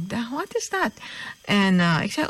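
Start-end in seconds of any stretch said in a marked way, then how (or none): 0.3-0.9 high and soft, taken aback; half to herself, caught off guard; soft high astonishment; astonished thought. Retold or imitated. Thought on hearing stranger's claim.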